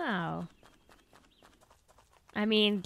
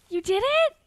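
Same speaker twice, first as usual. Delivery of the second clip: surprised; 12 semitones higher than usual. Real, not acted. Gasp then disbelief.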